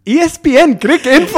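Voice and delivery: high, loud mock proclamation; triumphant answer. Ali answers the VP after a breath, loud and triumphant. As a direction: announce it like a winning answer. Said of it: "a, like, a breath".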